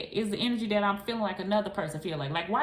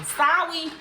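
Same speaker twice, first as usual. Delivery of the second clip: high, louder sing-song sorry; sorry tossed up high and loud; mock apology; 10 semitones higher, 9 dB louder than usual. Real, not acted. Apologizing to viewers for being away, high and loud.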